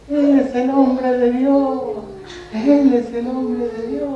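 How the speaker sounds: lightly voiced little girl, high and slow; a hint of a child's singsong; girl's voice. Preacher voicing the slave girl, lightly high. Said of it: "con voz de niña".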